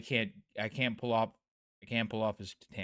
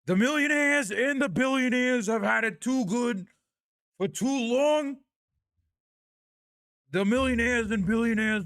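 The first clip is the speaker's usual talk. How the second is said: put-on high, loud, slow campaign-rally old man; Bernie Sanders impression; 12 semitones higher, 7 dB louder than usual. Streamer performs his Bernie for chat, loud and slow high rally voice. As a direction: hammer it out like a fired-up senator at a podium. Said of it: "sorry, my Bernie Sanders".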